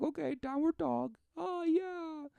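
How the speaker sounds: very high, soft falsetto; mock blissful pupil. Pupils enjoy the yoga instructor's attention, mock blissful falsetto. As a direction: coo in delight in a put-on falsetto.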